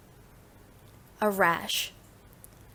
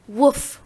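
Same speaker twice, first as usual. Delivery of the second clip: short, loud, higher woof; dog bark; 4 semitones higher, 7 dB louder than usual. Shown as a demonstration. Teacher demonstrating animal sounds, loud dog woof. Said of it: "Animal sounds".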